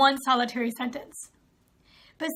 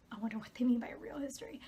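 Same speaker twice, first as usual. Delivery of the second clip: ordinary delivery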